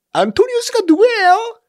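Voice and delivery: very high squealed question; mock-naive, high-pitched whine; clueless listener. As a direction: mimic a clueless student in a piercing high voice. Mimics forgetful audience asking.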